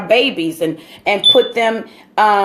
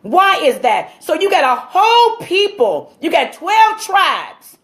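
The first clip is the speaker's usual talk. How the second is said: impassioned preaching; 6 semitones higher than usual. Acted. Speaker preaching to his listeners about slavery, fervent and rising. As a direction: build it like a sermon from the pulpit. Resumes preaching after aside.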